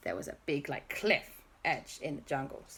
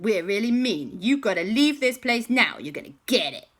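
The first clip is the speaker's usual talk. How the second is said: loud, raised-pitch menace; threatening; 5 semitones higher, 7 dB louder than usual. Acted. Armed villains ordering the group to leave, threatening. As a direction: order them out like a story villain.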